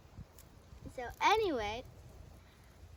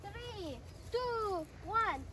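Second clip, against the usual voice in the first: high, rising countdown; excited countdown; 6 semitones higher, much slower than usual. Real, not acted. Countdown before jumping.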